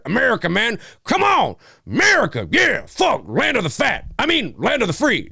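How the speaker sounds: high-pitched mock patriot; gung-ho American.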